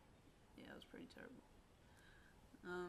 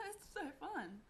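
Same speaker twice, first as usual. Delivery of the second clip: mock teasing; 9 semitones higher than usual. Girl taunting, laughing at her.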